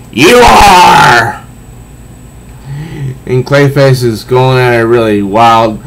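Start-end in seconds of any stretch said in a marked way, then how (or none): 0.1-1.4 loud, raised shouted retort; hammed-up comic-book shout. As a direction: fling it back at him. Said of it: "She says".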